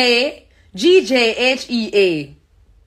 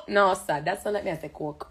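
ordinary talk, nothing marked

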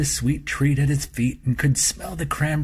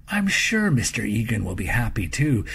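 ordinary delivery

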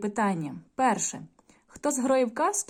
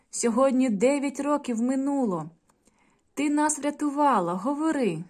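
urging brother; 6 semitones higher, 4 dB louder than usual. A narrator's reading. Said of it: "гукає".

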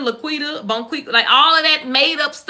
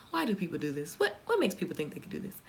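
incredulous mutter; 7 semitones lower, 13 dB quieter, faster than usual. Retold or imitated. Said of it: "looking at these comments like".

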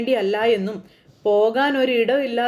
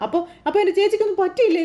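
startled question; 8 semitones higher, faster than usual. Retold or imitated. Uncle startled at news.

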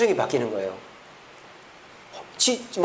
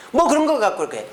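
dismissive brush-off; 6 semitones higher than usual. Shown as a demonstration. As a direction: shrug it off lightly, a bit higher. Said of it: "그러지 마시고".

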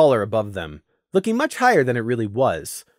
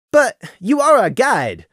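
raised, louder protest; 10 semitones higher, 5 dB louder than usual. A narrator's reading. Gloom protesting to the stag guide, raised voice. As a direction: push back hard on the guide. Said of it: "Gloom protested".

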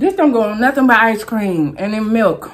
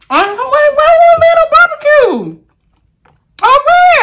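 much higher, louder protest; outraged squeal at the wheel; shocked outcry; 7 dB louder than usual. Real, not acted. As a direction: squeal in disbelief. Wheel lands on barbecue.

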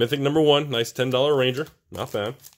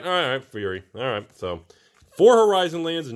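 ordinary talk

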